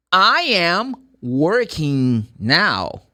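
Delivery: slow and deliberate, every word clear; very slow model sentence. Shown as a demonstration. Teacher dictating an example sentence very slowly. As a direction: drag it out for learners. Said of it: "Now check".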